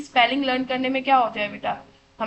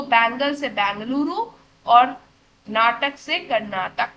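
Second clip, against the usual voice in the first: slow mnemonic; 4 dB louder, slower than usual. Shown as a demonstration. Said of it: "क्या बोल रही हूँ".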